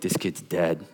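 low, hushed aside to himself; dread-filled aside. Retold or imitated. Counselor watching the kid's prayer go wrong, low hushed aside. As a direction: say it under your breath, low and resigned. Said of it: "I'm like".

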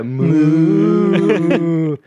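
loud drawn-out ghostly moo; haunting cow moo. Acted. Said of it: "you just changed the moo tone".

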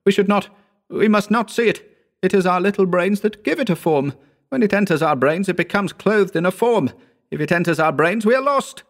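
high, panicked frightened friend; a man unravelling in terror; frightened Howard. A narrator's reading. Howard explaining the horror, high and panicky.

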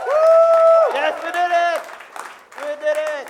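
high, loud victory whoop; triumphant cheer. Real, not acted. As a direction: cheer like you just won. Celebrating first live recording.

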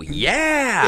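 high, drawn-out uncertain yeah; bluffing yes. Retold or imitated. Comic asked for a script, high bluffing yes. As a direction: stretch it up high as an obvious bluff.

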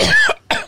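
short, sharp throat-clearing cough; demonstrated throat clearing. Shown as a demonstration. Host showing radio listeners an annoying noise, throat clearing. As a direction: clear your throat as an example. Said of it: "clearing your throat".

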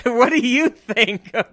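can't keep a straight face; laughing. Real, not acted. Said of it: "I can't even say that with a straight face".

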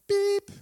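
high, loud beep like an oven timer; sharp electronic beep sound; imitated oven beep. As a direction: chirp it out like the oven.

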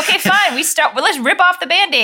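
high, loud, fed-up go-ahead; resigned Jesus. Retold or imitated. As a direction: ok fine, let's do this. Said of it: "now he's like".

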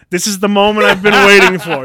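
loud, high mounting excitement; fired-up declaration. Acted. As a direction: declare it with building eagerness. Acted build-up before a fight.

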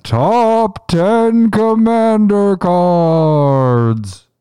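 very slow, high, loud announcer. Acted. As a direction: stretch every word like a game show host. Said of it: "the voice of that one".

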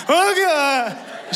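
high-pitched startled "Oh, God"; mock terror. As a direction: yelp it as the coaster drops. Ride suddenly launches.

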